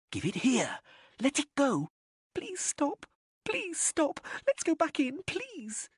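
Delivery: put-on high begging little-girl voice, desperate; pleading girl. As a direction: beg them to stop, near tears. Girl begging the boys to stop.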